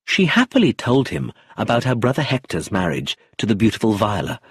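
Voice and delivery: usual speaking voice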